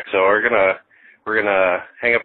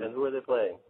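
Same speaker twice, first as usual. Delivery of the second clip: gentle prompting; 10 dB quieter than usual. Real, not acted. Father prompting his child softly.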